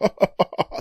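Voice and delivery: short high villain laugh; mocking cackle. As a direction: cackle as a parting jab. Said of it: "with the fucking laughing".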